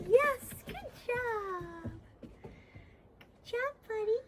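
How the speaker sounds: high sing-song praise; puppy praise voice. Real, not acted. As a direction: gush over a good puppy.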